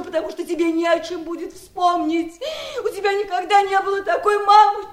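desperate outcry; anguished shout. Acted. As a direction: shout the last words in despair. Aunt's outburst peaks.

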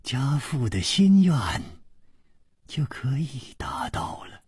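a soft, smiling hint that ends in a whisper; faint smile. A narrator's reading. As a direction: let it fade to a whisper. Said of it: "微微笑着说".

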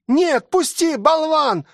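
put-on high-pitched, struggling child; high frantic cry of a boy; boy yelling. A narrator's reading. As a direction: yell an insult in a high boy's voice. Boy fights to get free.

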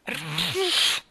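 loud honk into a hanky; trumpeting nose blow. A narrator's reading. The admiral blows his nose, trumpeting. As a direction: blast it like a trumpet. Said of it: "strombettò nel fazzoletto".

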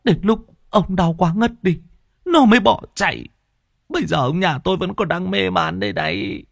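high-pitched, anxious woman's voice; high panicky wife recounting the attack; frightened village woman. Acted. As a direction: tell the horror breathlessly, frightened. Woman continues telling attack.

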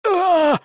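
sharp high cry of pain; scream of pain. Acted. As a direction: yelp as if stabbed. Leg pierced. (резкий крик боли)

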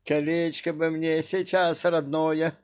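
high-pitched wistful lament; upset. A narrator's reading. King longing for his own ring, upset. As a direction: pine for it in a high voice. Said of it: "расстроился король".

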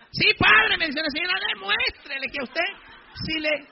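alto, animado, empujando; anímelo con sorna; señora entusiasta. Retold or imitated. (la señora anima al padre con burla)

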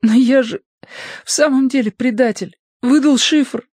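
high, thin-pitched character voice, upset; distressed child figure's confession. A narrator's reading. Notka admits being a traitor to friends, distressed high character voice.

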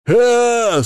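high, loud panicked wail; hysterical scream. A narrator's reading. Hamster sees his bewitched comrades, screaming hysterically. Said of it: "снова истерический вопль".

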